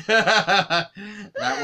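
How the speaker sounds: laughing out the words. Real, not acted. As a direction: chuckle through it. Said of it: "I made her snort".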